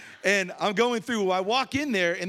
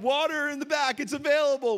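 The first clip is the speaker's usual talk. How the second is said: higher, store-clerk call; mock store announcement; 7 semitones higher than usual. Joking to Christina on FaceTime, a high mock store-clerk call. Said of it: "that's what I was saying to her on FaceTime".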